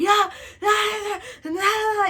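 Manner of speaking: high, loud imitated bawling; screaming and crying. Child caught by grandma, hammed-up screaming cry. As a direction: bawl so the words are lost. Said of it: "I'm screaming and I'm crying".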